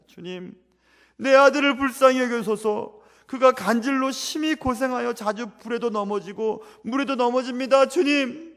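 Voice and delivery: loud, high desperate plea; father begging Jesus at full voice; father crying out. Retold or imitated. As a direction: shout your plea for your son. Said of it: "라고 외치는".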